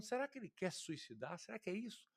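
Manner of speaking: soft, unsure musing question; wondering. Retold or imitated. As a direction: ask it quietly to yourself, puzzled. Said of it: "simplesmente pensando". (pensativo e baixinho, em dúvida)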